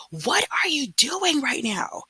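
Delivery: high, drawn-out exasperated outburst; slow, high-pitched teacher's yell, retold without full volume; retold scream. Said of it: "I literally screamed it".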